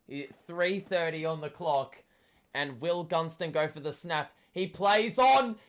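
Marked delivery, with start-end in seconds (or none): 4.5-5.6 building to a high, loud yell; excited shout. Real, not acted. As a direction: erupt as the goal goes in. Fan watching a goal.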